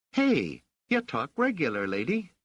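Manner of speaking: put-on high-pitched, cheeky kid voice; a kid impressed, in street talk; stunned street boy. A narrator's reading. Raich stunned at Rochelle's slang, high boyish voice. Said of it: "he managed to say".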